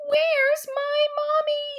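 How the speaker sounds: usual speaking voice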